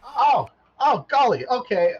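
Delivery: high, loud flustered robot; a startled little robot. Acted. Robot flustered by trivia news, high and loud.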